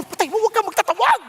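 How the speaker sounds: high, loud frantic mock call; retold yell at wife. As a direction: playfully shout her down. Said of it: "Sabi niya, eh".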